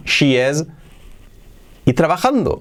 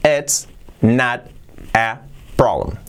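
slow and clear: "It's not a problem"; slow model phrase; much slower than usual. Shown as a demonstration. Teacher models the phrase slowly for learners to copy. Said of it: "Fíjate cómo suena".

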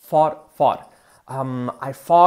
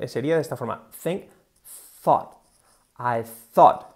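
ordinary talk, nothing marked

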